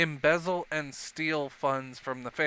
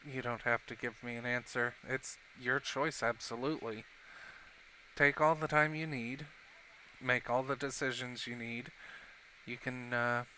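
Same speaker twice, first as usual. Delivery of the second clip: gently reassuring; 2 semitones lower, 7 dB quieter than usual. Acted. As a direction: quietly let them off the hook. Rex reassures the tearful Rosabella.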